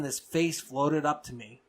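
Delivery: ordinary delivery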